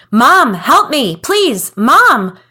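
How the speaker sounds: high, loud terrified cry for help, slowed; desperate scream. Acted. Boy grabbed from behind, screaming to mom for help. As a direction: scream for your mother as loud as you can. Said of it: "I screamed as loud as I could".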